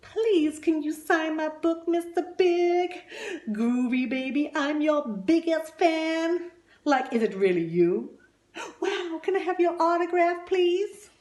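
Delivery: high, loud gushing fans; hammed-up excited fan voices; pleading fans. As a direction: beg for the autograph. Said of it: "they're saying".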